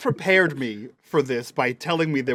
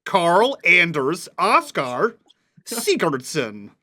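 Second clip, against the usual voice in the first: very American; 2 semitones higher, slower than usual. Shown as a demonstration. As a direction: say it as American as possible, drawn out. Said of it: "very American".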